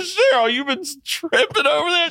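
very high, frantic call to a neighbor; hammed-up high-pitched disbelief; mock-hysterical call. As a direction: call across the curtain in a squeal. Acted shouting across hospital curtain.

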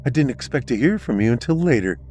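a lightly voiced mother on the phone; mother's voice. Mother answering daughter's call.

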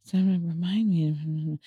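quiet, slow, lightly voiced mom dictating; a hint of mom talking to her phone; imitating mom. Son imitating mom dictating reminders, quiet and slow. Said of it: "she'll just like".

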